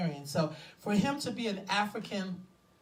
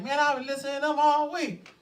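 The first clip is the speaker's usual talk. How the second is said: higher, louder self-quote; excited retelling; 7 semitones higher, 6 dB louder than usual. Retold or imitated. Host telling listeners about a new find, excited retelling. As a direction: blurt it out like a fresh discovery. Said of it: "That's what it felt like".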